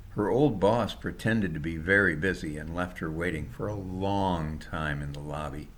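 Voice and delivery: plain reading voice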